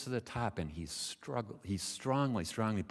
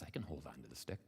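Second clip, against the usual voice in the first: low, soft answer; frog's reply; 9 semitones lower, 12 dB quieter, faster than usual. Acted. Frog assures the mother swallow, low and quiet. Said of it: "Frankie says".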